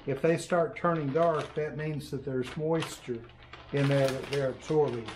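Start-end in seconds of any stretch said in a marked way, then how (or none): none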